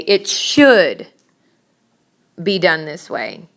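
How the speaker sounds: emphatic, stretched "should" in an argument; inflection on should. Shown as a demonstration. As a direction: hit "should" hard, pause, then finish evenly. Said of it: "You need to make an inflection".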